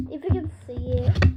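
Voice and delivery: soft, low mumble fading to a whisper; quick and under the breath; barely audible. Real, not acted. Talking to viewers about what can't be shown, barely audible. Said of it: "Sorry if you didn't hear me there".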